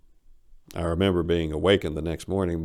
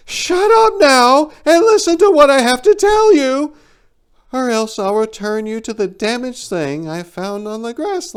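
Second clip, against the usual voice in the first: much higher and louder, cutting him off; impatient eagle shout; 11 dB louder than usual. Acted. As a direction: snap at him to shut up. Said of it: "She impatiently interrupted my musings".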